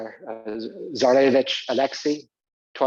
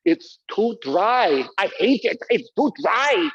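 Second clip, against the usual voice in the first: a hint of German accent, slow and complaining; mock German accent; 6 semitones higher, 4 dB louder, slower than usual. Teacher imitating the German empress on Protestantism, mock German accent. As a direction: lightly play the empress grumbling. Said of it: "she would say".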